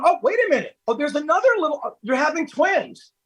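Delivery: high-pitched retold amazement; surprised discovery. Acts out the surprised ultrasound news.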